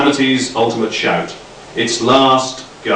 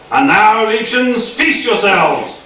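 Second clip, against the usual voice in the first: higher, slow gloating command; mock German villain; 10 semitones higher, slower than usual. A narrator's reading. Nick to the mice, gloating mock German command. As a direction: relish the order like a panto tyrant.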